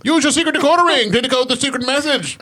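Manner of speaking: put-on old-time radio announcer. Mock vintage radio serial teaser.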